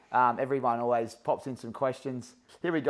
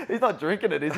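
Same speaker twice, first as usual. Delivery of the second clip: laughing; 7 semitones higher, 4 dB louder than usual. Real, not acted. Laughing at story.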